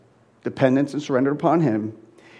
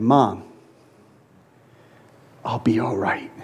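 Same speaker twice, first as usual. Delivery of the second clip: soft, low, gentle reassurance; tender farewell; 6 semitones lower, 5 dB quieter than usual. Retold or imitated. Son reassuring his dying mother, soft and tender.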